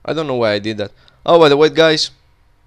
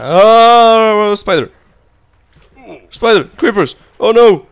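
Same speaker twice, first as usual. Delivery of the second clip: high, loud alarmed yelps; panicked cry at sudden monsters; real fright shouting at the spiders; startled panic; 9 semitones higher, 8 dB louder, slower than usual. Real, not acted. Spiders and creepers appear suddenly.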